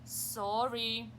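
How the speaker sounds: slow, loud sign reading; reading a note. Acted. Teacher reading the cows' note, loud and slow. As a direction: read the note out boldly.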